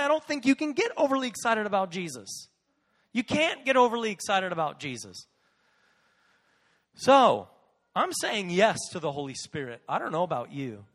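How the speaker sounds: ordinary talk, nothing marked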